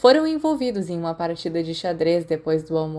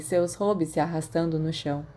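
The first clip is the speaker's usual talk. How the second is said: ordinary delivery, nothing marked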